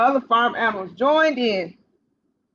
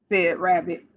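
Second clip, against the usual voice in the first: ordinary delivery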